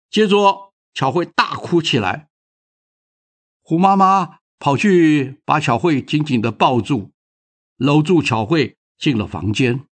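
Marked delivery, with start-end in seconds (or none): none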